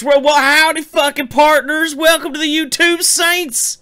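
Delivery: howdy like a Western cowboy; fake cowboy accent. Live show intro, cowboy greeting.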